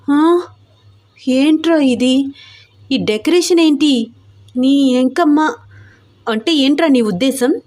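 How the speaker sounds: scandalised wife, high and taken aback; shocked. A narrator's reading. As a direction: demand an explanation in disbelief, pitch up. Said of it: "shock అయిపోయింది".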